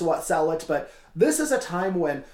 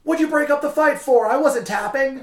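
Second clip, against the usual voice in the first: high, loud, whiny outrage; indignant protest; 10 semitones higher, 6 dB louder than usual. Retold or imitated. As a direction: act betrayed by the ref. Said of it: "then he's like".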